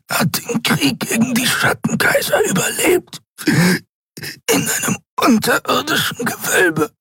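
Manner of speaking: hohe, erstickte Stimme mit langen Atempausen; dünn wie Nebel. A narrator's reading. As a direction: ring zwischen den Satzteilen nach Luft. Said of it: "Seine Stimme war dünn wie Nebel". (dünn, gequält und atemlos)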